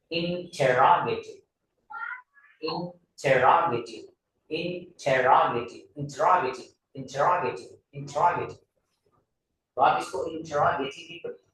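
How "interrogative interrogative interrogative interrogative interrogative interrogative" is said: interrogative said first very slowly, then quicker, as "inchera-geti"; correct pronunciation drill. Shown as a demonstration. Teacher drills the right way to say interrogative. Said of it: "सही pronunciation आप इसका इस तरह कर सकते हैं".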